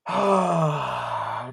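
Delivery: long, heavy exhale in character; weary sigh. Acted. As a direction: let out an overworked official's sigh. Weary captain reacts in scene.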